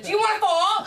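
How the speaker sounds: loud, high sudden outburst; grandma coming out. Retold or imitated. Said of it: "my grandma came out of me".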